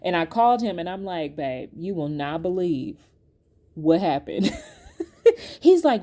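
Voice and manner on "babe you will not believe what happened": slow, lower retold confiding; confiding lead-up. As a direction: draw it out, building to news for a partner. Retold call to her husband.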